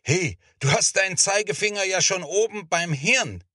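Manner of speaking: high-pitched gleeful jeer; jeering hoot. A narrator's reading. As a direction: hoot it like a teasing schoolboy. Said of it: "johlte Markus".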